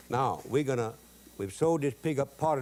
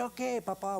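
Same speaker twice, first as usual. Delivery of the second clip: meek son; 9 semitones higher, 5 dB quieter than usual. Retold or imitated. Son answering his papa.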